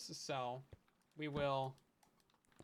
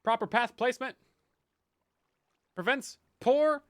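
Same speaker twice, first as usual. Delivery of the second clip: mock slogan; 5 semitones higher, 8 dB louder than usual.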